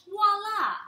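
high, loud flourish; triumphant voila. Acted. Child showing off the finished manicure. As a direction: present it proudly.

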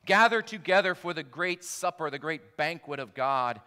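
loud cry. A narrator's reading. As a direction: call it out to everything in the sky. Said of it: "who cried in a loud voice".

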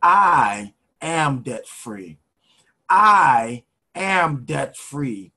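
very slow declaration, building in volume and pitch; lead the affirmation. Shown as a demonstration. Coach has listeners repeat after, slow building affirmation. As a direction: draw out each word, firmer every time. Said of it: "I want you to say this with me".

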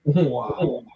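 ordinary talk

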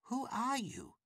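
ordinary delivery

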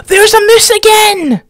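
high, slow gasped exclamation; stretched-out, high-pitched surprise; alarmed excitement. Real, not acted. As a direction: gasp and announce it in real shock. Gasps as a moose reappears.

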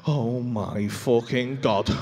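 very low, slow realisation; deep, drawn-out dread; appalled. Retold or imitated. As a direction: drag it out low, word by word. Retells his shock after a gasp.

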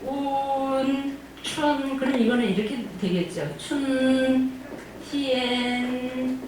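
운, 춘, 티엔 drawn out very slowly; syllables said very slowly; slow syllable demo. Shown as a demonstration. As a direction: stretch each syllable out. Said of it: "치읓에다가".

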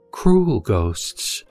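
ordinary talk, nothing marked

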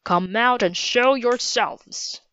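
lightly voiced captain giving orders; a hint of military command. A narrator's reading. Captain orders hidden children out.

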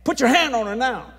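loud, high hollered order; hollered command. Retold or imitated. Preacher to father Ken, full hollered command. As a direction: shout it across to make him obey. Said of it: "I hollered at Ken".

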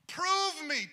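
God daring the doubters, loud high challenge; bold challenge. Retold or imitated. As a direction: dare them, loud and high on the word prove. Voicing God's challenge, second take.

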